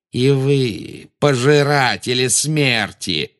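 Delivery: high, slow, drawn-out menace; Volan-de-Mort, cold. A narrator's reading. Dark lord rebukes his failed servants, slowly and coldly. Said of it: "высокий холодный голос".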